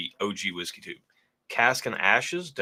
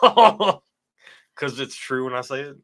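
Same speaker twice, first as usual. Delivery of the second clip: laughing; 4 semitones higher, 6 dB louder, much slower than usual. Real, not acted. Teasing back at his wife.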